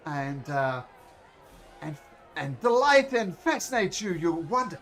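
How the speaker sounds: booming high showman's welcome, then slowing down; grand ringmaster. Acted. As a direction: announce it like a circus ringmaster to the crowd. Ringmaster introduces first act.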